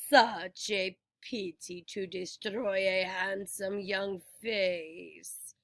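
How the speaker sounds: low, slow, soft soothing voice. A narrator's reading. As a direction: lull him gently. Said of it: "she told me soothingly".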